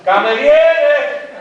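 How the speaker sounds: drawn-out loud call for the waiter; long slow shout across the room. Acted. Calling the waiter loudly.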